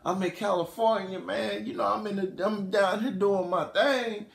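smooth-talking come-on, climbing high; soft opener sliding into high playful sweet talk. Retold or imitated. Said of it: "or [name]'s like".

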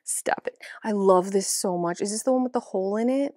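normal talking voice

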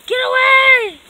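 loud yell to back off; frightened shout. Acted. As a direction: shout it to drive them away. Captive yells at villain.

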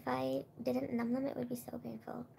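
breathy whisper of pain. Real, not acted. Whispering to friends about the pain. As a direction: whisper it like it really hurts.

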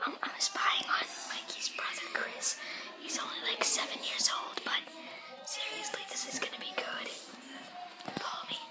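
hushed sneaky whisper. Real, not acted. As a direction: whisper so he can't hear. Sneaking up, spying on brother.

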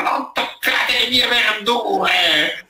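put-on slow, high cranky old crone; hammed-up grumpy granny; grumpy old woman. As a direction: scold the kids off her vegetables, drawn out. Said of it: "questa vecchia cigna che gli diceva".

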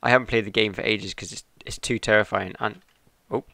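ordinary delivery

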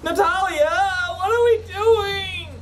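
high, slow, near-crying whine; a frightened half-sob of a question; begging the partner for answers, close to tears; whimpering plea. Real, not acted. Panicking, pleading with companion.

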